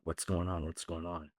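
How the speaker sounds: hushed bystanders murmuring to each other; puzzled murmur. Retold or imitated. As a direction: murmur it low, as a puzzled onlooker. Onlookers' puzzled murmur in story.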